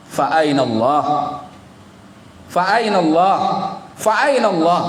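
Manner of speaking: pleading call to the sky, rising; crying out. Retold or imitated. Shepherd cries to the sky, then where is Allah, rising cry. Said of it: "Dia teriak-teriak begitu".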